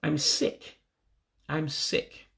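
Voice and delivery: usual speaking voice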